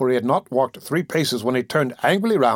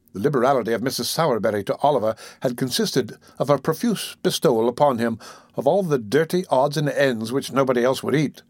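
ordinary delivery, nothing marked

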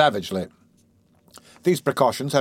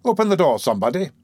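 ordinary delivery, nothing marked

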